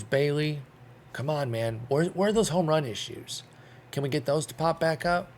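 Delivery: normal talking voice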